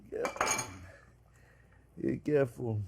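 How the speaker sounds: a hushed, breathy-free whisper of caution; whispered warning. Real, not acted. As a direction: whisper it under your breath. Warning repeated while pouring.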